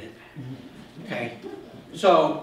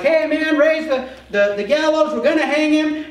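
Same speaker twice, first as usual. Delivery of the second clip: high, loud, eager shout; cheering him on to the hanging; gung-ho family; 12 semitones higher, 10 dB louder than usual. Retold or imitated. Haman's family the night before, high and loud with eagerness.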